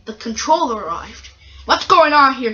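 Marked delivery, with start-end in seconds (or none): 1.7-2.5 loud, higher-pitched cross demand. Acted. Controller arrives on the scene, cross. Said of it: "he said crossly".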